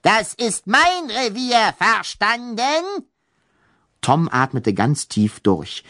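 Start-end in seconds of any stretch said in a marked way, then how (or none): 0.0-2.8 menacing ghost baroness. Acted. Ghost baroness threatens Tom.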